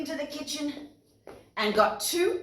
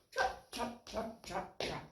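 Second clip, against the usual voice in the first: whispered trotting; 11 dB quieter than usual. Acted. Rhythmic trotting sound words.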